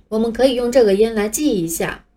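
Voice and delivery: usual speaking voice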